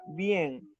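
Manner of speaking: "bien" drawn out slowly as the strong syllable; stressed syllable VIEN. Shown as a demonstration. Teacher naming the strongest syllable, stressed slow "bien". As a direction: stretch "bien" on its own. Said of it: "la sílaba más fuerte sería".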